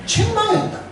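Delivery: 책망했다 jumps up high then falls; emphatic reveal. Acted. Preacher to congregation, 책망했다 sprung high with surprise. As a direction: land 책망했다 like a punchline. Preacher reads rebuke climax.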